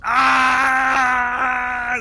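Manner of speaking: high loud scream of pain; agonized scream. Acted. Cloaked man on fire melting, agonized scream. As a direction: scream as the flames take you. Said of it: "and he goes".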